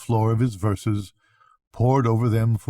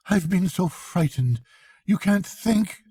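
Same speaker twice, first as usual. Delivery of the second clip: much higher, trembling relief; frightened; 9 semitones higher than usual. Acted. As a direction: still scared as he pours it out. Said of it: "he cried".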